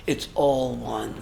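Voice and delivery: It's all one, repeated low, soft and slow; hushed It's all one; soft echo. Shown as a demonstration. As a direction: let It's all one sink in quietly.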